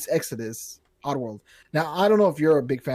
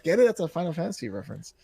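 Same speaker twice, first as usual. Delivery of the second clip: normal talking voice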